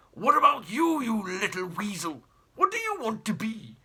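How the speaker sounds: put-on haughty old explorer, barking at the family; pompous great uncle. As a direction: look down your nose and bellow. Jeremy bends over the girl.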